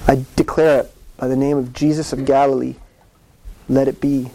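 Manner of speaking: a hint of solemn old-Bible pronouncing; King James speech. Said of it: "They talk like that".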